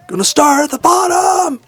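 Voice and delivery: very high falsetto mock scream. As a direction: scream it far up high like a live singer. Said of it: "[name] would scream it".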